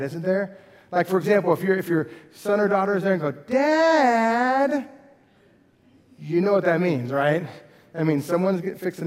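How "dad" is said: put-on high, loud whiny child calling dad; a kid about to tattle, drawn-out and high; child whining to dad before telling on a sibling; tattling kid. Shown as a demonstration. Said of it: "different ways that you can say the word dad".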